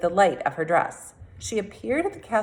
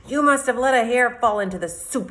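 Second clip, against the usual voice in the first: lightly voiced cook's growl, a touch louder; 6 semitones higher, 4 dB louder than usual. A narrator's reading. Cook growling at Furball in the kitchen, mild growl. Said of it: "he growled at Furball".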